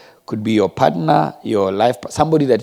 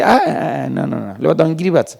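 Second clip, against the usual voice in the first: mock-alarmed refusal, starting high; acted refusal; 4 semitones higher than usual. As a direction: back away from it in mock protest. Acts out a patient refusing surgery.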